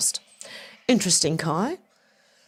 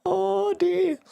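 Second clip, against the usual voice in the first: high squeaky laugh into oh dear; 12 semitones higher, 3 dB quieter than usual. Real, not acted. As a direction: laugh in disbelief. House set on fire story.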